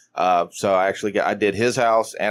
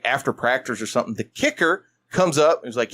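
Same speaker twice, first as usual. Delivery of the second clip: ordinary talk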